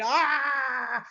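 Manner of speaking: long, high ah; hammed-up drawn-out wail; high puppet cry. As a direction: let out a high, held puppet ah. Demonstrating the Kermit move.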